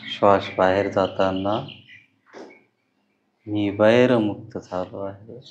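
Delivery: slow meditation voice, words drawn out; guided meditation. Acted. Teacher guides loving-kindness on each breath, slow guided meditation. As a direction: lead each breath slowly, with long pauses and lingering endings.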